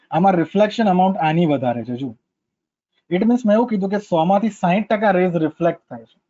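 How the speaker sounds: ordinary talk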